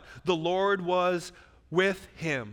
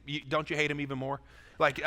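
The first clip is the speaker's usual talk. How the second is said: usual speaking voice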